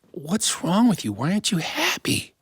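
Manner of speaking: high, pleading queen voice; a wife fretting over her husband; worried queen. Acted. Queen pleads with unhappy king.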